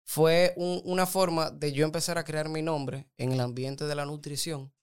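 ordinary talk, nothing marked

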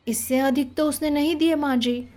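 put-on mildly dejected, apologetic boy; sad. A narrator's reading. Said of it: "उदास चेहरे से कहा". (हल्की उदासी से, माफ़ी माँगते हुए)

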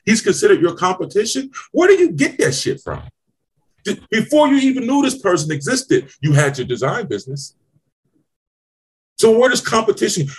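high, incredulous, scoffing tirade; exasperated rant. Real, not acted. Ranting about competition mindset.

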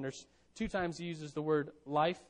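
ordinary talk, nothing marked

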